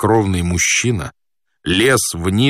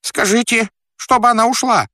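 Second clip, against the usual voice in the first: high, agitated demand through a door; squealing voice; 14 semitones higher, 3 dB louder than usual. Acted. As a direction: order it in a squeal. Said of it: "раздался визгливый голос". (требовательно, визгливым высоким голосом)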